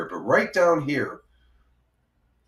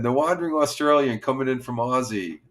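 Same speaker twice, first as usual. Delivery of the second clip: normal talking voice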